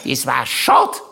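put-on high dry old aunt voice; deadpan regret in a squeaky old woman's voice; lightly voiced old aunt; Aunt Mari quoted. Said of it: "allen noch einmal gesagt hat".

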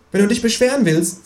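high kangaroo voice, pleased with itself; the kangaroo gloats about its scheme; cheeky kangaroo. A narrator's reading.

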